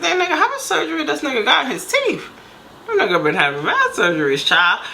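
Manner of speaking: high-pitched, mock incredulous question; retold disbelief. Woman reacting to his surgery story, retold incredulously. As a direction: jump up in pitch, doubting the excuse.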